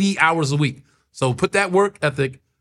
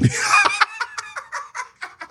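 high, falsetto burst of laughter; big laugh. Real, not acted. Comic cracking up at his own joke, high laugh.

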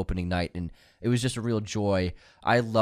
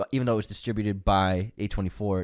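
ordinary talk

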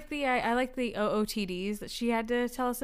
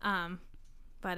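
usual speaking voice

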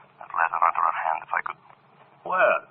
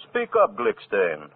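demanding interrogation; 10 semitones higher than usual. Acted. Maestro interrogates nervous violinist.

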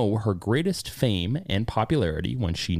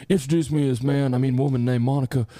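Bill Clinton impression; 4 semitones higher than usual. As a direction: play the ex-president, bragging. Playing Bill Clinton in first person.

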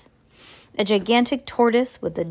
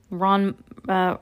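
ordinary delivery, nothing marked

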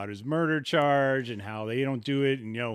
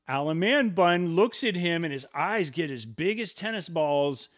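usual speaking voice

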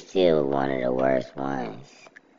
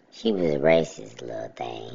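ordinary talk

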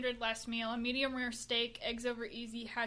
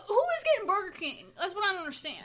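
incredulous; 6 semitones higher, 7 dB louder, faster than usual. Real, not acted. Baffled by Burger King choice.

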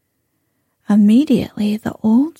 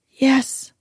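trembling; 3 semitones higher than usual. A narrator's reading. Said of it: "Trembling in every limb".

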